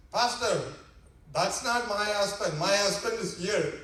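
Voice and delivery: acted loud objection; indignant wife. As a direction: call out to the pastor in protest. Wife corrects pastor before congregation.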